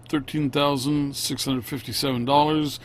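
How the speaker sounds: normal talking voice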